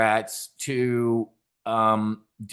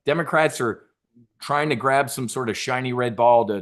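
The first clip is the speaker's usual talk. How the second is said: normal talking voice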